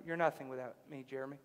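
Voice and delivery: very soft, low and unmoved; quiet humbling reply. Acted. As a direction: cut through the bluster in a hushed, low voice. God repeats the quiet reply.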